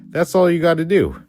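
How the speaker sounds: high, fast sarcastic punchline; mock coach. Shown as a demonstration. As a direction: sell the joke like a trainer. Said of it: "best exercises".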